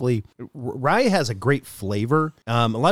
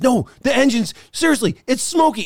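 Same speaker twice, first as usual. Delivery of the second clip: worried mother; 7 semitones higher than usual. Retold or imitated. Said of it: "she says".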